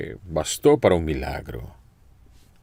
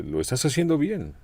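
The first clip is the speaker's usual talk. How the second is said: affectionate encouragement; 10 semitones higher than usual. Retold or imitated. Older brother encouraging his little brother, affectionately. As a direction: lift him up like an older brother. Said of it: "como cuando el hermano mayor le habla al menor".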